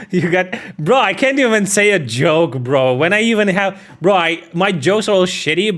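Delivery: loud, high frustrated rant at yourself; exasperated self-mockery. Real, not acted. Streamer who fumbled a joke, loudly exasperated. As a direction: complain loudly over your own botched delivery.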